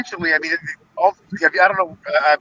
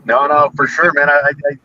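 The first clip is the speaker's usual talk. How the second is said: usual speaking voice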